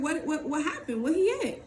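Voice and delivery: rising, stumbling alarm; panicked questions. Retold or imitated. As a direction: fire questions as fear builds. Panicking on hearing of police.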